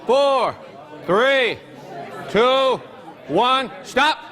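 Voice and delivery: high, carrying countdown to the whole room; teacher's countdown over the room; calling time. Real, not acted. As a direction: count them down and call pens up.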